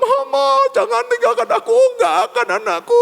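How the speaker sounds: high melodramatic pleading, hammed up; Korean drama reunion. Imagined mother and son vowing never to part, hammed-up falsetto. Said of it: "kayak drama Korea".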